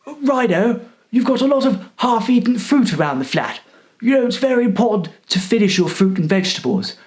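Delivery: a mock fussy old cleaner lecturing; hammed-up nagging; nagging old man.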